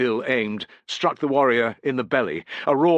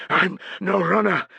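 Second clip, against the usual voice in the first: out of breath, higher voice; wheezing; 9 semitones higher, 4 dB louder than usual. A narrator's reading. Metilius wheezing as he runs beside Piso. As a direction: gasp it out mid-run. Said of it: "wheezed Metilius".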